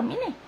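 "Mine" said softly as "MEE-neh"; Spanish reading. Shown as a demonstration. Vlogger explains a viewer's name, "Mine" as "MEE-neh". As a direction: say "Mine" as two Spanish syllables, quietly. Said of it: "Así como se lee en español".

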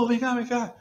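high called-out summons across the yard; imitating a guard calling from afar. Guard calling him over.

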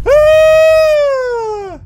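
long, high, loud scream; panicked scream. Real, not acted. Player caught by the glue, panicked scream. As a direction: scream out at full voice, holding the note.

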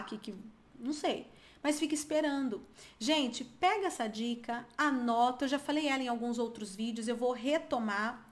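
usual speaking voice

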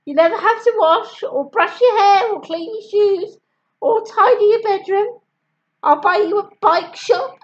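high, fast desperate bribing; pleading mum. A narrator's reading.

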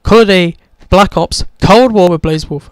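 ordinary talk

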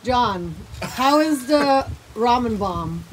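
normal talking voice

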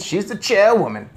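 high, loud insistence; astonished cry. A narrator's reading. Father defends the chairwoman, astonished cry. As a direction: insist in disbelief. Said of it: "cried Mister Jenkins".

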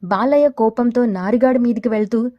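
usual speaking voice

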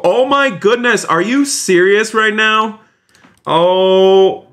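high, stunned exclamation, stretching out; overwhelmed disbelief. Real, not acted. Streamer reacting to another big donation, amazed.

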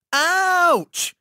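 high-pitched yelp of pain; a sudden, drawn-out high cry; screamed. A narrator's reading. Boy bitten by ants on a rock, screaming. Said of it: "I screamed".

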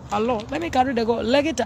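usual speaking voice

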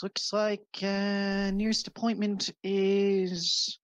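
high, slow, officious clerk voice; cleric woman reading schedule. Acted. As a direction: read the date off slowly, officiously. Cleric reads booking ledger.

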